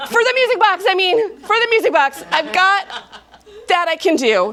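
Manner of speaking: high, giggly backpedal; embarrassed laughing correction. Acted. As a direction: laugh off a double meaning. Addie correcting herself.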